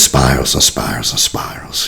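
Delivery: hushed, breathy spiraling; whisper. Real, not acted. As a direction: whisper it as the worry spins.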